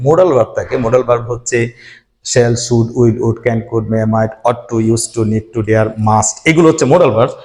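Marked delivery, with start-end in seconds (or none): none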